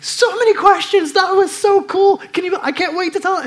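a high giddy voice ending in a laugh; giddy disciple. Retold or imitated. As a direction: gush like an excited kid. Disciples thrilled after the mountain.

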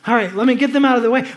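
ordinary talk

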